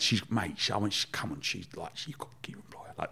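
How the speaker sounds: trails down into an unvoiced hush; from plea fading into whisper. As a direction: drop it to a confiding whisper.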